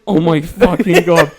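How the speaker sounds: high, loud laugh through disbelief; laughing in horror. Real, not acted. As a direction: laugh out the shock. Horrified laughing reaction.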